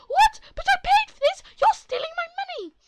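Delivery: very high, very loud outraged shriek; shrieking mom. Acted. Entitled mother shrieking at the worker about her money.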